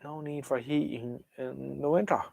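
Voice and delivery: ordinary talk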